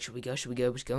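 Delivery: very soft, repeated murmured question; hushed conferring. Retold or imitated. Group confers about going outside, imitated hushed murmur. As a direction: imitate a group quietly checking with each other. Rivals confer among themselves.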